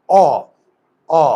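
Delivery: অ said with the jaw dropping wide open; open অ demo. Shown as a demonstration. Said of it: "আমার মুখের চোয়াল খুলে যায়".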